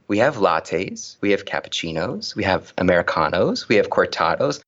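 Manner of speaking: each item rising at the end; recited spiel. Retold or imitated. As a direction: list the drinks one by one, lilting up on each. Barista reciting the coffee list.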